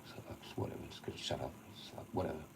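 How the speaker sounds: dropping to a mutter. Real, not acted. Giving up on his rant, a soft mutter. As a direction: mutter it under your breath, giving up.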